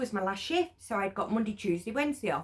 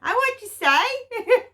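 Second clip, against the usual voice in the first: high, loud, slow puzzled huh; mock-deaf asking again; exaggerated confused huh; 13 semitones higher, 8 dB louder, slower than usual. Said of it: "whatever I sounded like".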